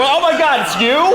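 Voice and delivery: very high, loud gushing recognition; mock delighted surprise. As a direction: squeal at spotting a fellow actor.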